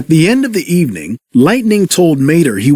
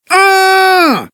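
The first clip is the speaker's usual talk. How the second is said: higher, strange shriek of fright; terrified scream. Acted. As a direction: scream at the looming shadow. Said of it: "Mater screamed".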